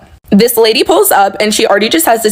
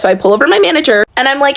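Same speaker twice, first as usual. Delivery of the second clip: ordinary delivery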